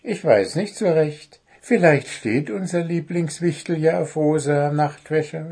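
lightly voiced, hesitant owl character; pondering little owl. A narrator's reading. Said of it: "überlegte er".

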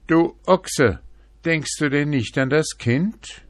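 a donkey rebuking the ox; loud donkey scolding; angry donkey. A narrator's reading.

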